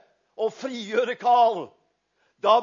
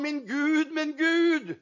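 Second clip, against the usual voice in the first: quoting Jesus's cry; 6 semitones higher, 7 dB louder than usual. Retold or imitated. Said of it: "men nå sa han".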